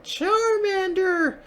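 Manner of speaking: very high, drawn-out creature cry; baby lizard yelling its name, long and shrill; Charmander cry. Acted. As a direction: squeal it like a baby fire lizard. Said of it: "and yelled".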